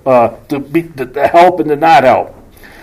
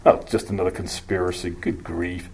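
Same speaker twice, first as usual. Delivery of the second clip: low, soft, fast brush-off; quiet mock-weary dismissal; dismissive; 9 semitones lower, 14 dB quieter than usual. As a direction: shrug it off with a quiet sigh. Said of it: "you can rightly divide it and go".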